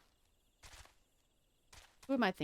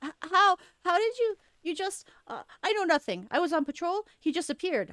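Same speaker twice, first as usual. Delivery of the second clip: high, stammering panic; flustered denial; 10 semitones higher, 7 dB louder than usual. Acted. Laurentius caught, stammering high-pitched excuses to the adventurer. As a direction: babble excuses, caught red-handed.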